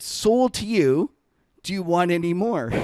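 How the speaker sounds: high, slow, cheeky sell; cocky offer. Retold or imitated. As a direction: lay it on him, taunting. Cocky reply to a bond trader.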